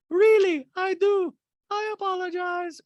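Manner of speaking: high, loud put-on Diana voice; Diana impression. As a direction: chirp it up high like a prissy socialite. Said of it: "Diana's like".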